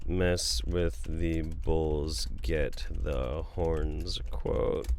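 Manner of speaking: very slow, low and soft; drawn-out search query. Real, not acted. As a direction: stretch each word while looking up a quote. Looking the movie quote up aloud.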